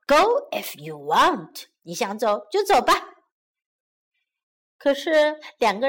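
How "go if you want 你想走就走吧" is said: ordinary delivery, nothing marked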